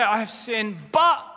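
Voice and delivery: BUT jumps high and loud after a flat "I have sinned"; half admission, big but. Retold or imitated. Preacher mocking the congregation's excuse, loud but. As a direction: land hard on "but".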